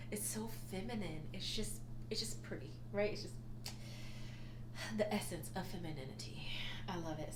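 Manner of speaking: breathy near-whisper, mostly unvoiced; hushed admiration. Real, not acted. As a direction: whisper it like it's precious. Admiring a soft cardigan.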